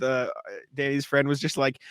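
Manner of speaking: ordinary talk, nothing marked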